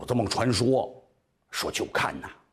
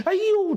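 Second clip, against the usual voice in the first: high, loud crying wail; hammed-up high wail; 5 dB louder than usual. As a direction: burst into a wail before the court. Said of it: "他这一哭啊".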